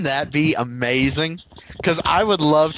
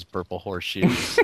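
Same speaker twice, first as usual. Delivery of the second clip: usual speaking voice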